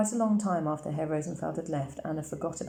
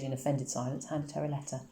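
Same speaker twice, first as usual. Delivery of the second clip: ordinary delivery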